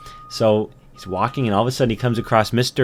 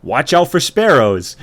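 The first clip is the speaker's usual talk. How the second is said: loud, raised bird voice; warning sparrow; 5 semitones higher, 7 dB louder than usual. Acted. Sparrow about to dive at the ant, loud warning cry. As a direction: call out a sudden warning. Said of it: "And he says".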